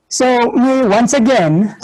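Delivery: ordinary delivery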